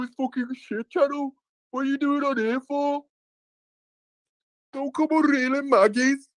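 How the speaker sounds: high, heavy Brummie accent, mocking an angry chatter; exaggerated Brummie rant, pitched well up; mock Birmingham accent. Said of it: "I was doing a Birmingham accent".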